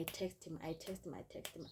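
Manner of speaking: unvoiced trailing whisper; fading whispered aside. Real, not acted. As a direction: let it fade to a whisper.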